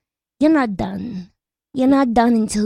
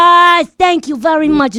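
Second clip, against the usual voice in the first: loud, higher burst of thanks; excited thanks; 6 semitones higher, 5 dB louder than usual. Acted. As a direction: throw out a big grateful thank you. Relieved it's boar's head.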